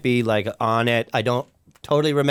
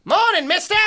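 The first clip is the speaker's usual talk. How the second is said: cheery paperboy. Acted. Paperboy greets from bicycle.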